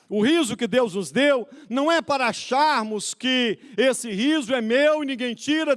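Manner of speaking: high-pitched pulpit exhortation; full-force preaching. Real, not acted. Preacher rebuking believers who keep their laughter, full-force preaching. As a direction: preach it in rising waves.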